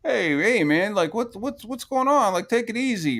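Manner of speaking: high-pitched imitation of a peacemaker; Yellow Flash. Friend stepping into the argument, high-pitched calming plea. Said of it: "Yellow Flash was like".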